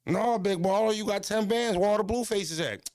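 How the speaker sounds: high, sarcastic jeer; mocking taunt. Teasing the friend about flaunting cash, high and sarcastic. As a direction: needle him for flexing money.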